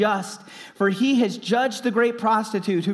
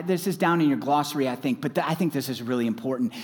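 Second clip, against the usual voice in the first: ordinary delivery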